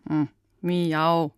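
a timid, half-hearted cat meow; weak meow. Pretend. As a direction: mumble a shy little miau. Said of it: "was war das denn?".

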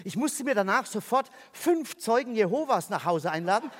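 normal talking voice

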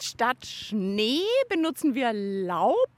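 normal talking voice

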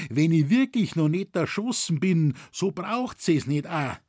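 alarmed dialect outcry; crying out. A narrator's reading. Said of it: "rief er".

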